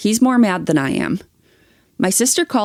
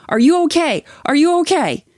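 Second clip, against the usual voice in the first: retold yelling; 8 semitones higher than usual. Husband yelling at his injured wife, retold. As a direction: repeat it as if shouting to her. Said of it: "my husband grabbed me yelling".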